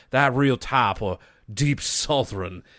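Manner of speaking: slow Deep South drawl; imitated Deep South accent, drawn out; deep southern accent. Shown as a demonstration. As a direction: stretch it into a Southern "tahp". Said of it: "deep southern, uh, type of accent".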